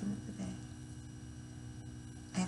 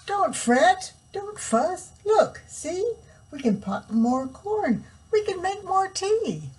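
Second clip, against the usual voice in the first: high squeaky little mouse; 10 semitones higher, 5 dB louder, slower than usual. Acted. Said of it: "Mouse squeaks".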